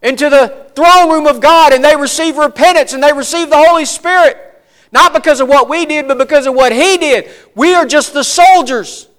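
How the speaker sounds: loud, high fiery preaching; preaching at full force. Acted. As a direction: preach it out to the congregation.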